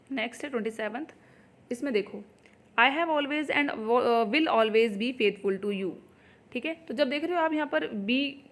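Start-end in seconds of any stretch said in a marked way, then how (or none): 2.8-5.9 slow, word by word sentence reading; slow dictation read. Shown as a demonstration. Teacher reading the exam question to students, slowly. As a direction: spell the sentence out for the class. Reads test sentence aloud.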